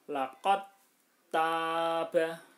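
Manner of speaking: "Laqad taba" recited loud, the d dropped into a doubled t; "Laqad taba" in loud tajwid recitation, no d heard; merged dal into ta. Shown as a demonstration. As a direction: chant "Laqad taba" as laqat-taba, long first a in taba. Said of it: "dalnya dihilangkan, taknya ditasdidkan".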